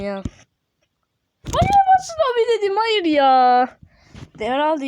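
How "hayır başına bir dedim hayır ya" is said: very high wail sliding down; loud, high, falling lament; wail of protest. Real, not acted. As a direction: cry out in frustration at losing. Upset at losing progress.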